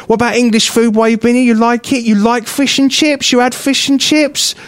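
high, insistent mock questioning; imitated condescending old man, high-voiced; patronising.

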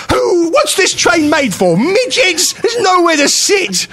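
very high-pitched, drawn-out indignant rant; ranting outburst. Retold or imitated. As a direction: re-enact a comic tantrum, stretching the words. Re-enacts his rant on train.

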